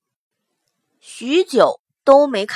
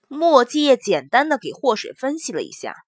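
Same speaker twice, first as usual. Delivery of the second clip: usual speaking voice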